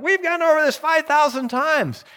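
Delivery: high-pitched, worked-up parent's outburst; exasperated parent. Retold or imitated. Parent to the kids after many repeats, exasperated. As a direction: imitate a parent at the end of their patience. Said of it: "as parents we've gone".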